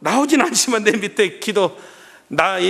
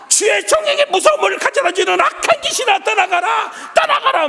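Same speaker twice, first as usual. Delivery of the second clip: very high, urgent exorcism cry; frightened self-protective prayer. Retold or imitated. Scared minister ordering evil spirit away, praying frantically for himself. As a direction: command the spirit out in a high, driven voice. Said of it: "내 기도만 만서면서 하고 있는 거예요".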